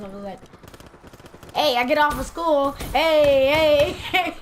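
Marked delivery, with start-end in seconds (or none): 1.5-4.4 loud, higher cheer with a laugh; mock victory whoop. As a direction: celebrate getting out of school. Cheering at getting suspended.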